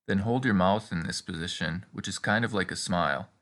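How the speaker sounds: ordinary talk, nothing marked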